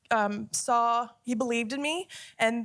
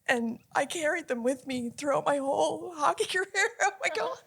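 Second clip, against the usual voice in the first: teary voice breaking into a high laugh; tears overtaking the sentence; crying again; 5 semitones higher than usual. Real, not acted. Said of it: "I'm not a pretty crier".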